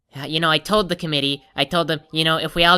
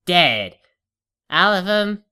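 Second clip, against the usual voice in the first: ordinary talk, nothing marked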